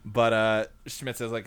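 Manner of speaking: normal talking voice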